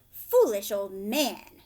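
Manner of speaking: loud, slow scornful scoff. A narrator's reading. Young Tom scoffs at Old Pat, loud and slow. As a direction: sneer it down at the old man. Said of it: "scoffed young Tom".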